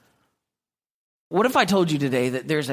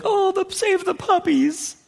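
high, gushing mock pity for the puppies; mock sappy gush. Viewer moved by a sad puppy ad, mock gushing high voice. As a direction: ham up a soppy viewer cooing at puppies. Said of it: "And you're like".

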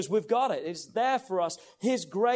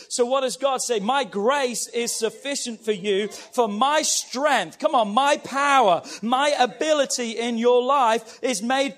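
loud, rising sermon build; preacher building to a louder climax; fiery preaching; 3 semitones higher, 6 dB louder than usual. Acted. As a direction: drive it home to the congregation. Preaching at full force.

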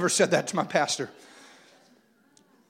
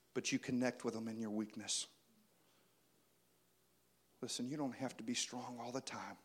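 soft preaching; 9 semitones lower, 13 dB quieter than usual. Acted. Intimate soft sermon passage.

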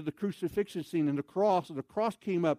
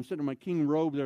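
usual speaking voice

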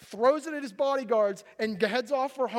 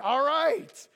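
loud, higher burst of surrender; exasperated giving in; 6 semitones higher, 5 dB louder than usual. Acted. Samson finally gives in to nagging wife, exasperated. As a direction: give in to the nagging, raised voice. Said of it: "finally, he's like".

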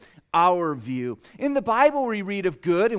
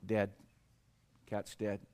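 blunt; 9 semitones lower, 10 dB quieter, slower than usual. Retold or imitated. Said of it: "why did you say it like that".

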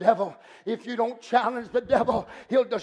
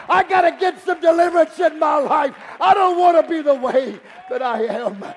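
loud, insistent revival cry; impassioned pulpit shout; full-force preaching; 3 semitones higher, 8 dB louder than usual. Real, not acted. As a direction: preach it hard, repeating with mounting urgency.

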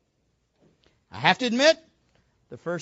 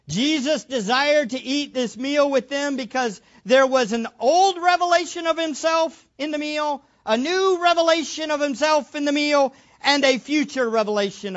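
higher and louder, driving each phrase; building emphasis; 6 semitones higher, 5 dB louder than usual. Real, not acted. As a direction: preach it with rising force. Preacher builds to climax.

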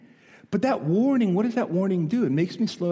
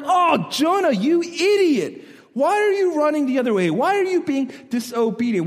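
exasperated scolding; 9 semitones higher, 4 dB louder than usual. Retold or imitated. Reader scolding Jonah.

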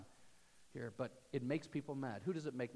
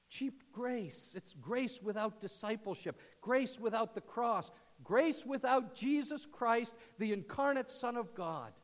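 building preaching; 6 semitones higher, 6 dB louder than usual. Real, not acted. Sermon climax builds.

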